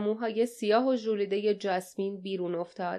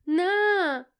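sudden high desperate shout; a sharp anguished yell; 9 semitones higher, 4 dB louder than usual. A narrator's reading. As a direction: cry out in alarm as he stumbles. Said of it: "فریاد زد".

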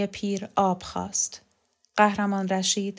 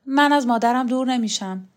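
loud, higher protest; defiant refusal; 4 semitones higher, 6 dB louder than usual. A narrator's reading. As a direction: stand your ground, raising your voice. Refuses order to leave mother. (امتناع با صدای بلند و معترض)

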